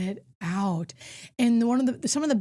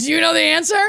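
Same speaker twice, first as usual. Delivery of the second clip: loud pleading question; 8 semitones higher, 10 dB louder, faster than usual. Retold or imitated.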